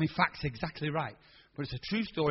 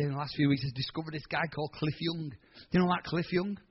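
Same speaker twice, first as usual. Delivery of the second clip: ordinary delivery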